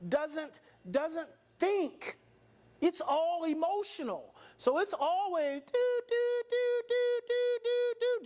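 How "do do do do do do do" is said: high, even radar-like beeps; scanning beeps. Shown as a demonstration. Speaker imitating the amygdala scanning for threats, high repeated beeps.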